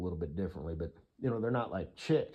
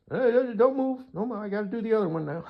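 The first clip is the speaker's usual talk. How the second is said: mock hurried woman; 12 semitones higher, 6 dB louder than usual. Mimics woman rushing a video.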